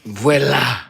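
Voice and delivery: a light French voilà; French accent. Retold or imitated. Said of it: "As the French say".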